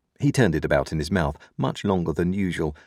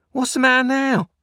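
very high, loud, sharp demand; 6 dB louder than usual. A narrator's reading. Sister demanding an answer from Joe, very sharply. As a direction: demand it sharply, pitched right up. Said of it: "more sharply than before".

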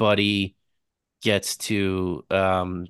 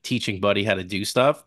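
usual speaking voice

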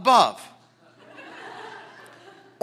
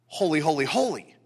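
"holy, holy, holy" as three even beats; triple emphasis; 6 semitones lower, 4 dB quieter than usual. Shown as a demonstration. Preacher showing triple emphasis, repeated "holy". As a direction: give each "holy" its own weight. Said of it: "See the triple emphasis?".

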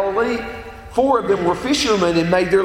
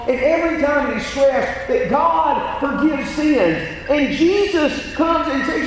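normal talking voice

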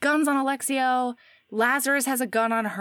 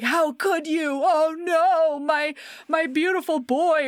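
high, loud, hammed-up wailing; mock anguished lament; 7 semitones higher, 4 dB louder, slower than usual. Bad guys playing on her guilt, mock anguished lament. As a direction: wail it like overdone melodrama.

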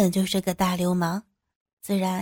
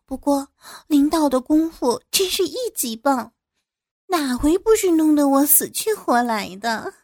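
high, flirty giggling voice; coquettish laughing; 5 semitones higher than usual. A narrator's reading. Younger woman flattering the boss, coquettish laughing. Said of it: "张娜浪笑着说".

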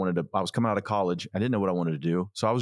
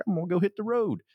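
put-on Southern accent; 12 semitones higher, faster than usual. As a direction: say it like a Southern country singer. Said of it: "why'd you go into your, like, your Southern accent?".

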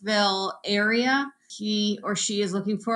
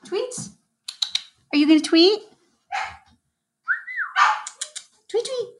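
high coaxing pet talk; talking to a bird; 12 semitones higher, faster than usual. Real, not acted. Coaxing her lovebird to tweet.

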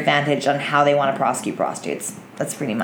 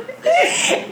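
high squealing laugh; high giggle. Real, not acted. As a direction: burst into giggles. After the chant.

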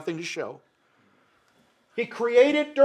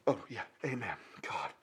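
whispered amen; 11 semitones lower, 16 dB quieter than usual. Acted. Humbled sinner accepts rebuke.

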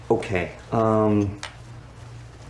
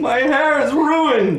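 much higher, slow, wailing; outraged wail; 4 dB louder, slower than usual. Acted. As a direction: wail about the hair. Character wails.

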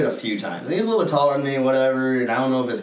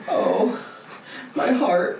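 mock heartbroken; 9 semitones higher, much slower than usual. Mocking heartbroken guy.

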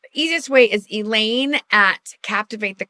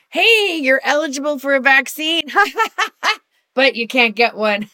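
higher, louder chirpy official voice; mock official announcement; 5 semitones higher, 5 dB louder than usual. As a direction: cheerfully announce it like a bureaucracy. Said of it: "New Jersey keeps going".

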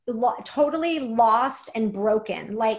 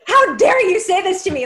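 loud indignant outburst, pitched up; outraged; 7 semitones higher, 8 dB louder than usual. Retold or imitated. Retelling her rage at the inner voice, loud indignant cry. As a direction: hurl it back in disbelief and fury. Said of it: "I was pissed".

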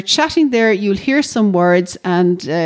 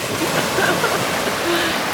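startled laugh; 9 semitones higher, 5 dB quieter than usual. Real, not acted. Water catching her toes.

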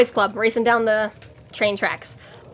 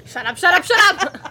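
laughing protest; 8 semitones higher than usual. Real, not acted. Laughing at a teasing joke.

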